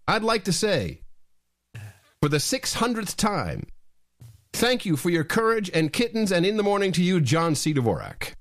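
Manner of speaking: high sing-song show-opening salute; ritual greeting. Acted. Host opens the show greeting his co-host, high ceremonial sing-song. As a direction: hail your co-host like a ceremony.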